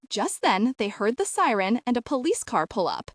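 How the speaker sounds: plain reading voice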